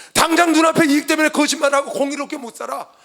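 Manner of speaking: loud, high-pitched pulpit exhortation; real impassioned sermon shout; full-force preaching. Real, not acted. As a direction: proclaim it fervently to the congregation.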